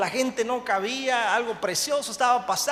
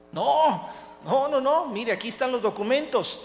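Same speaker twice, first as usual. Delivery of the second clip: alarmed protest; 5 semitones higher, 3 dB louder than usual. Acted. Pastor protests the accusation.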